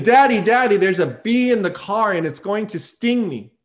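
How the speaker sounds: mock scream, easing off after the first cry; retold child's scream. Frightened daughter to her dad in the car, imitated screaming. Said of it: "the girl starts screaming".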